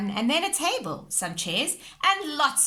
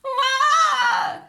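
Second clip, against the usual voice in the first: very high, loud sobbing wail; toddler wail; 11 dB louder than usual. A narrator's reading. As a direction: wail as the dinosaur floats away. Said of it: "sobbed George".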